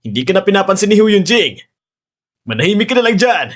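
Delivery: high, rising jeer; menacing taunt. Acted. Kidnapper threatens to toy with the captive, menacing taunt. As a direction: taunt the helpless captive, building in pitch.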